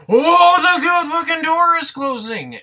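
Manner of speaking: very high, very loud hammed-up shriek; mock panicked scream. Reviewer mocking the closing-door scare, hammed-up screaming. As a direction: scream it like a horror victim, overdone.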